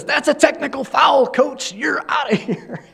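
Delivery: retold referee tossing the coach, a hammed-up yell; mock referee shout. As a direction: throw him out with a half-laughed shout. Referee ejects coach, laughing retell.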